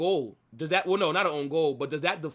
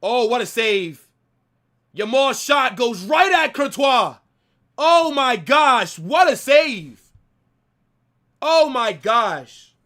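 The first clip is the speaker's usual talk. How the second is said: excited; 6 semitones higher, 9 dB louder, slower than usual. Real, not acted. Big save watched live.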